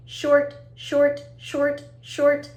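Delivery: staccato "short", each one cut brief; short sound. Shown as a demonstration. Teacher modelling short sounds for children, brief and staccato. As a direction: say each "short" as a quick separate beat. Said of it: "the sounds sound long and short too".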